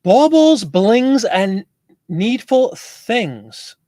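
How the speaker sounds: high, loud start, then drawn right out; grand announcement. Real, not acted. Host welcomes viewer with an odd username, grandly announced. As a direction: savour each word of the name like a showman.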